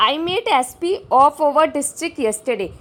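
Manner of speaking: slow, loud, deliberate reading; teacher reading the question aloud slowly; slow dictation read. Shown as a demonstration. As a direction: spell the sentence out slowly for the class. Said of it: "reading exam sentence slowly".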